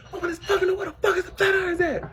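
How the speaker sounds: high, furious shout through the door; mother yelling. Retold or imitated. Mother yelling at daughter through the locked door, high and furious. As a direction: bang on the door, demand it open.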